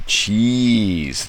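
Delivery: an urgent hammed-up cry of "Cheese!"; retold scream. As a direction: shout "Cheese!" as a warning from afar. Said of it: "the halfling is screaming".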